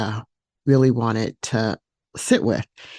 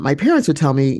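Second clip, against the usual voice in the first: ordinary talk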